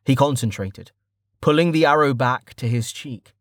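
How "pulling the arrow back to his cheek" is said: ordinary delivery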